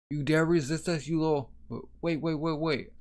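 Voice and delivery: high-pitched acted threat, breaking off; indignant outburst. Guard threatening a suspect until someone intervenes, indignant. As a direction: flare up, then interrupt in quick repeats.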